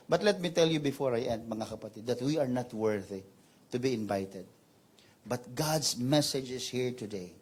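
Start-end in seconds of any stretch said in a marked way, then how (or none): none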